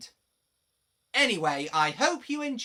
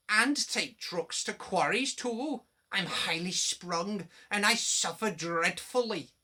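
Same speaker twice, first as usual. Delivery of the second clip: sheepish; 4 semitones higher, 3 dB louder than usual. A narrator's reading. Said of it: "Sir Handel said sheepishly".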